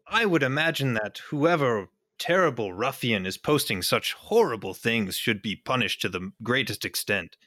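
ordinary talk

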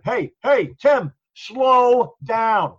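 loud, high command, slow and stretched out; assertive call-out. Shown as a demonstration. Officer telling the driver to slow down, assertively. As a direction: call over the siren to slow him down. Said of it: "If you assert yourself while you're driving".

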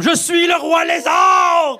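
high, loud, slow proclamation; lizard king shout. Retold or imitated. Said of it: "il va faire comme".

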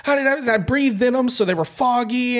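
teasing friend with a high, whiny excuse voice; mocking his friend. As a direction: play the flustered buddy making excuses. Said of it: "I was like".

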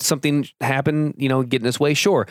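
normal talking voice

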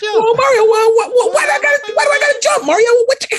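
very high, whining Luigi voice; high mock Luigi voice. Luigi complaining to Mario about jumping, in a very high whine.

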